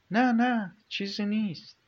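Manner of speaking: high, hurried, caught off guard; flustered denial. A narrator's reading. Dorsa flustered when asked if something is wrong. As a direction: deny it quickly in a fluster.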